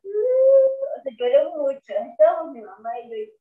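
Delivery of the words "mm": a high, short whimpering "Mm"; mock tearful high whimper; imitated sob. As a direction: imitate the sound of crying at the movie. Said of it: "fue como".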